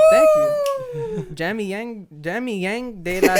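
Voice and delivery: hammed-up Spanish flourish; a hint of mock Spanish accent; flair. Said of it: "You gotta add some flair".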